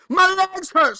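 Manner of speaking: high, loud whiny complaint; frustrated student. Retold or imitated. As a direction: play the fed-up kid protesting. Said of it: "pupil complaining in the story".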